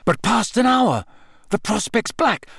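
high, dazed recitation; horror-struck recital. A narrator's reading. Harry reciting the riddle at the elf, horror-struck. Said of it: "Harry recited, staring horror-struck".

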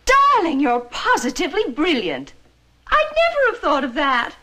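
high mock-gushing praise; sarcastic. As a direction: gush with sarcasm. Sarcastic praise of rattle idea.

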